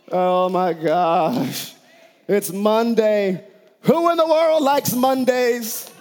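mock sleepy grumble about Mondays; groggy complaining. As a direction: moan like someone who can't get up on Monday. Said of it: "stay in bed going".

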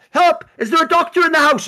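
high, loud mock panic for a doctor; mock cry for help. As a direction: ham up a frantic call for help. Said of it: "someone's like".

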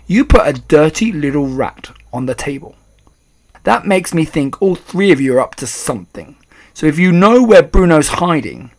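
loud, indignant accusation; rude and angry. A narrator's reading. Father demanding his hidden son from grandmother, rude and angry. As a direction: build up to an emphatic demand. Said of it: "He spoke rudely and he looked very angry".

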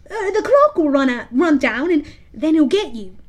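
high, loud fawning pirate; Smee voice. Acted. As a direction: warn him in a high voice. Smee's voice.

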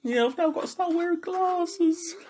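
high, slow whiny moaning; mock lament. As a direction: wail it like a tragedy. Dad laments needing glasses.

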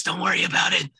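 whisper. Retold or imitated. Quoting the plane scene.

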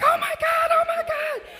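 hearing the lost luggage was found, a high joyful squeal; overjoyed disbelief. Retold or imitated. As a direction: shriek it like you just got the best news of your life. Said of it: "I was like".

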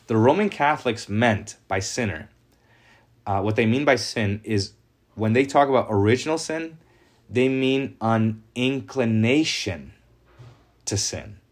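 usual speaking voice

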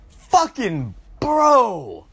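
drawn-out moan. Real, not acted. Overwhelmed by chocolate craving.

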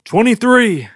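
mock prisoner's shout. Somebody down the corridor, shouting out. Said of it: "I heard somebody shout out".

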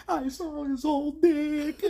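very high, slow fake sob voice; mock crying. Movie woman crying about the flasher, hammed-up sob. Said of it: "but she was crying".

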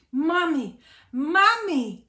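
loud, high call of mami; shouting for mum. Acted. Brunda bursts in calling loudly for his mum. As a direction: yell for mum across the house. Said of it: "křičel Brunda".

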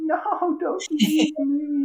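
put-on high, whiny child's wail; stompy child. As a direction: pout like a little kid. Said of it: "the little stompy child inside me going".

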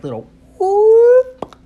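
very high, loud, slow little whoop; whoop sound effect. Shown as a demonstration. Describing his sly turn, a high loud whoop noise. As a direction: squeak out a long high whoop. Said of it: "if it made a noise, that's the noise it made".

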